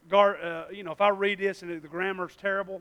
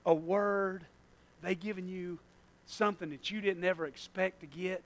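ordinary delivery

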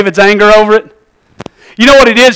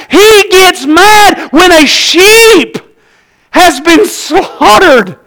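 higher, slower preaching build; fiery preaching; 7 semitones higher, slower than usual. Acted. Pastor to congregation, fiery slow preaching. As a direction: preach it hard and slow at the church.

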